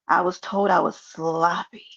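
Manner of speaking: ordinary talk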